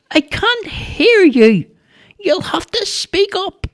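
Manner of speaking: put-on very high, slow old lady voice; a hard-of-hearing granny calling back; deaf old woman. A narrator's reading. Missus Muldoon asks Charlie to speak up, high old-lady voice.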